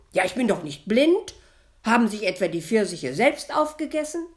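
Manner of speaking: high, loud, indignant scolding; shrill-free loud high rant; scolding outburst. A narrator's reading. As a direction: launch into an angry tirade. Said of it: "legte die Schwiegermutter los".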